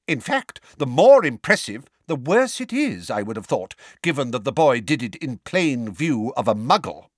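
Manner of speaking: high, emphatic testy argument. A narrator's reading. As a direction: argue it testily, punching key words. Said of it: "in a testy voice".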